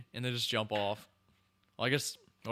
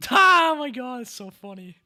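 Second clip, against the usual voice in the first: laughing delight; 11 semitones higher, 10 dB louder than usual. Real, not acted. Right after laughing at the scene.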